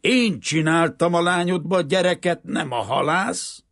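loud, high-pitched furious outburst; rude roar. A narrator's reading. Banker claiming the child as his own, roaring rudely. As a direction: bellow it crudely at full force. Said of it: "ordult föl a bankár gorombán".

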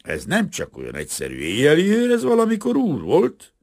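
high, loud call across the room; loud warning. Acted. Bricklayer calls out a loud warning to the carpenter. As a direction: call out to warn him. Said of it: "hangosan odaszólt".

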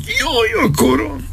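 shrieking high in sudden agony; a sharp, thin cry of pain that breaks out of him; pained scream. A narrator's reading. Colonel screaming as his arm fails him, agonised shriek. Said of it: "Ordította el magát".

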